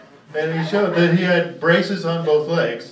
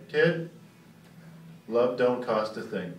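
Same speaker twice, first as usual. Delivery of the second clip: softer, lower mock-sincere line; mock tender; 6 semitones lower, 6 dB quieter, slower than usual. Comic voicing Harry's sweet moral to the crippled kid. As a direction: fake heartfelt moral.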